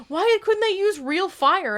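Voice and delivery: high, drawn-out questioning voice; mock boyfriend. Host quoting boyfriend's question about the movie, mock boyfriend. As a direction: imitate him in a slow, high-pitched complaint. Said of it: "my boyfriend was like".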